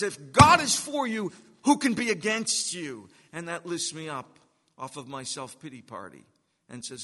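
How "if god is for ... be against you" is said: loud, high preached proclamation of the verse; ringing proclamation. Acted. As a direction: proclaim it to the whole church, full force.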